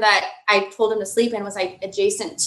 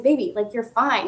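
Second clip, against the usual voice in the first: usual speaking voice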